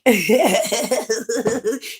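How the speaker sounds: rapid run of chuckles; mocking laugh. Real, not acted. Taunting someone she just called weak, mocking laugh. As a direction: laugh at their weakness.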